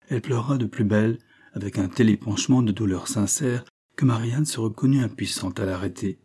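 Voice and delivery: usual speaking voice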